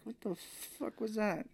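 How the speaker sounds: high, soft, slow disbelief; an officer staring at the bandages; incredulous. Retold or imitated. Officer sees bandaged arms.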